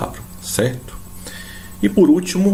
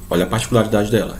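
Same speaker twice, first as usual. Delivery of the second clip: ordinary delivery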